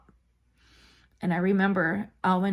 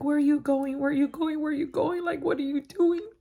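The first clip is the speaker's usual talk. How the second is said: urgent repeats, rising higher to the end; retold panicked questioning; 5 semitones higher than usual. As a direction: relive the alarm, voice high and climbing. Recalls panicked questions in moving car.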